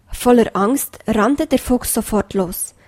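ordinary delivery

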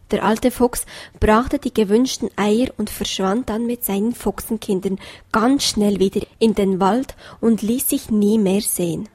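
ordinary delivery, nothing marked

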